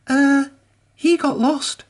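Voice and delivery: high engine voice, a touch of wheeze; Emily squeaks out an excuse, high; wheezing Emily. A narrator's reading. Emily fibs to Elizabeth, high and lightly wheezy. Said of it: "wheezed Emily".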